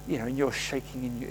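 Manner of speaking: ordinary delivery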